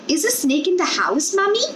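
put-on high, small child's voice asking anxiously; a little girl worried about the snake. A narrator's reading. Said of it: "the small girl asked".

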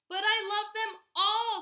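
put-on high, loud childish protest; crying out. A narrator's reading. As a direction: wail it out, pleading to keep them. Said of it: "Spencer cried".

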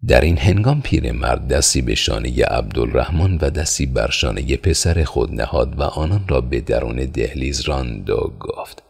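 ordinary delivery, nothing marked

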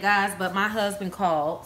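ordinary delivery

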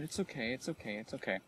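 soft, repeated calming-down murmur; self-soothing. Real, not acted. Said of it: "I'm not gonna get mad".

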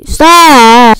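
loud yelled stop; raging gamer. Real, not acted. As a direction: yell at the chaser. Chased in game.

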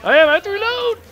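high, loud retort; exasperated shout. Real, not acted. Player ordered to shoot needs reload, loud exasperated yell. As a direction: yell back under pressure. Yells back when ordered.

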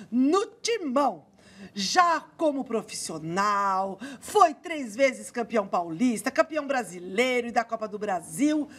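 drawn-out sports-show announcer delivery; punchy, ceremonious host intro with slow emphasis; TV presenter introduction. Acted. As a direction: introduce the guest with fanfare, stretching key words. Host's show opening and guest introduction.

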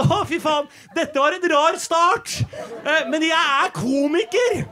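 high, slow, laughing save; awkward rescue. Retold or imitated. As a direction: try to rescue the dying joke. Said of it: "prøver jo det jeg kan å si sånn". (høy, nølende redning med latter)

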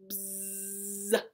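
long low buzz; imitated bee drone; bumblebee buzz. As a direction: buzz like a bumblebee, held steady. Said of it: "He can sound like a bee".

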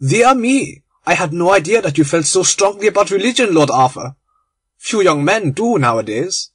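a lightly voiced German, amused and mock surprised; amused German conspirator. German teasing Lord Arthur about the Dean, amused. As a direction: tease him gently about religion.

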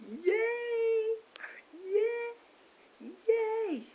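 very high, loud yay squeals; buzzing squeals. Real, not acted. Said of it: "I am kind of buzzing".

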